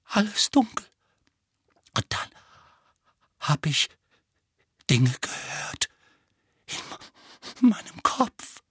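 put-on halting, frightened boy with long pauses; hoarse, haunted and faltering; hoarse, shuddering. A narrator's reading. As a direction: stammer it out in broken pieces, horrified. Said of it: "sagte Dudley heiser und erschauderte".

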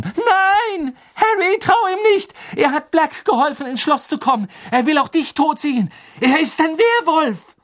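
high, loud, desperate shouting; frantic warning shout. Acted. Girl screams a warning to Harry about Lupin, frantic and loud.

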